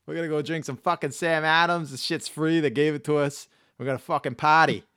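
put-on rowdy Boston bro accent. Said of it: "Sorry about my Boston accent".

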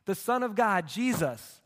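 ordinary talk, nothing marked

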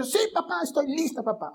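put-on high, soft little-girl voice; eager little daughter. Little daughter telling dad she is ready for the ride, eager. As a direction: pipe up like a keen five-year-old. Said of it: "Nicole, ¿estás lista".

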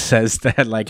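ordinary talk, nothing marked

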